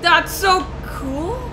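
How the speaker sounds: high, slow, rising awe; excited. Real, not acted. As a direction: let it climb in wonder. Repeats reaction, different tune.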